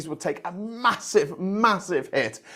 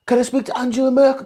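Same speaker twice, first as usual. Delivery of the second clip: higher put-on voice asking for Merkel; mocking Boris Johnson demanding Merkel on the line; mock Boris on the phone; 7 semitones higher, 3 dB louder, faster than usual. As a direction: pitch it up like a pompous caller. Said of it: "So then he's on the phone".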